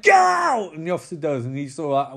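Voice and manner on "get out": very high, loud mock shout; shouted order. Boss throwing an officer out, high yelled order. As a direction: yell it as a sudden dismissal.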